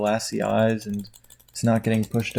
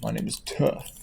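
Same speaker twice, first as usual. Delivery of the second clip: softer mock intro, "Tough" like the word tough; mock movie Toph; 7 dB quieter than usual. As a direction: quietly parody the wrong name. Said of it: "Wouldn't have been tough".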